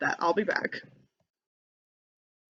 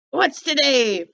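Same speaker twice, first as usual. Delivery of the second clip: put-on slow, loud call from an old man at a window; Scrooge calling down; 6 semitones higher, 5 dB louder, slower than usual. Scrooge calling down to a boy in the street, loud and slow.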